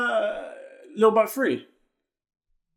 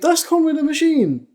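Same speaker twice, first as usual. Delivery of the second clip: raised pitch, insistent discovery; emphatic insistence; 6 semitones higher than usual. Real, not acted. Co-host telling the doubting host it is that rapper, emphatic. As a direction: press the point in a higher voice.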